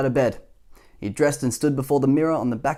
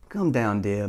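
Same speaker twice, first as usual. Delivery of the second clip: soft, lower coaxing mother; gentle coaxing; 5 semitones lower, 3 dB quieter, slower than usual. A narrator's reading. Mother coaxes her beetle son down, gently and softly. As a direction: coax him down gently, like a mum.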